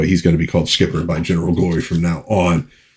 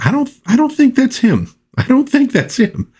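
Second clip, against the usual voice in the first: high-pitched imitation of a doubtful woman; mock female voice. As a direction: imitate her in a high falsetto. Acting out a woman's doubtful reply.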